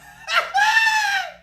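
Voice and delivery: very high, loud laugh; piercing put-on cackle; squealing cackle. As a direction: shriek with comic laughter.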